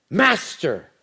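normal talking voice

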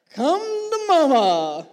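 high, loud, slow, crying out; hammed-up sultry wife. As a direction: ham up her invitation. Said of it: "And then she says".

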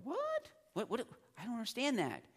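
high-pitched, incredulous "what?", hammed up; baffled reaction. Teacher mimicking a student puzzled by Greek idioms, baffled. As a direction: act out a confused reader, voice jumping up. Acting a confused reader's reaction.

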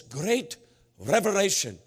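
"great revelation" with mock Norwegian sounds and punch; mock Norwegian accent. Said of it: "he went on and on".

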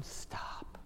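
hushed, unvoiced whisper as God; whispered plea. God to people hiding their sin, whispered plea. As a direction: whisper it to halt them. God quietly halting the earners.

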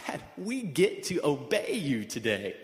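put-on slow, laughing child's offer; cheerful kids. Kids tell dad gladly, with a laugh. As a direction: say it beaming through a laugh.

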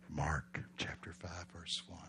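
low, slow near-whisper; hushed repeat. Real, not acted. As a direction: drop right down to set the scene. Repeats the reference in a hush.

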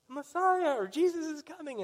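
high, loud cry of news; excited calling out. Acted. Imagined neighbor spreads word that Jesus is coming, excited call. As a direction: call it out high over the crowd.

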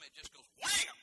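loud high wham with a laugh; big V8 roar, laughing; engine roar. Retold or imitated. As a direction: blast out the big engine sound. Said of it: "Mine doesn't go whoom".

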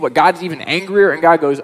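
ordinary talk, nothing marked

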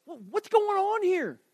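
high, loud, baffled demand; incredulous. Acted. David stumbling on the idle army, incredulous. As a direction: pitch it way up, dumbfounded at them.

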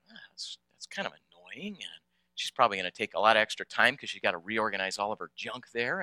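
very soft, under-the-breath private grumble; hushed inner thought. Retold or imitated. Traveller privately judging a woman cutting in, hushed. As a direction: keep it low, as if thinking aloud.

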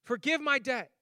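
high, loud, slow pleading debtor; begging. Retold or imitated. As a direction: beg the king desperately. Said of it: "he begged the king".

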